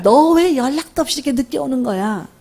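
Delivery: high-pitched annoyed parent's nagging; acted-out burst of irritation; irritated scolding. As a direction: snap at a child who came home late. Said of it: "막 짜증내".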